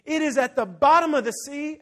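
high, loud declaration; jubilant proclamation. Retold or imitated. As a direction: proclaim the pardon with full force. Preacher proclaiming forgiveness as Jesus.

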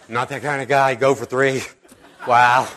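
real laughter through the words; laughing. Real, not acted. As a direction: laugh it out, loud and amused. Laughing at his own story.

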